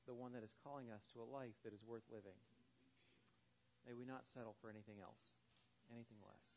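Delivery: hushed prayer voice at the close; closing prayer. Acted. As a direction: pray it over the congregation, winding down to a hush. Closing prayer after sermon story.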